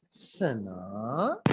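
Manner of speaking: सना drawn out, swooping low then sharply up; question intonation. Shown as a demonstration. Trainer demonstrating a question, सना long and rising. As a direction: stretch सना and end on a steep rise. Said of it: "ये एक question है".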